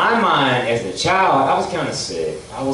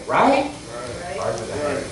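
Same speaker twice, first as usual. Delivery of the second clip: usual speaking voice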